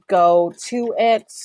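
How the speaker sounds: "go to it" said slowly and loud; slow careful demo. Shown as a demonstration. Teacher demonstrating the full phrase slowly. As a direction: spell out go to it slowly. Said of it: "kind of the same reference there".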